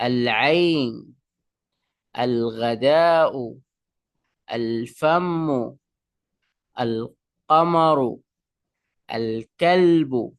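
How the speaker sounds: teacher's word drill, every "আল" with a distinct l; slow, careful "আল" before each word, l never dropped; clearly sounded lam. Shown as a demonstration. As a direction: sound the l in each "আল", drawing out the noun slowly. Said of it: "আলিফ লাম উচ্চারণ হয়েছে".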